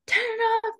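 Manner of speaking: high, eager shout-like quote; excited call. Retold or imitated. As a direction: hype the teammates up. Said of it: "Karen was like".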